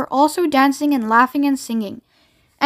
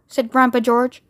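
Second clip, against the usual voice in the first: ordinary delivery, nothing marked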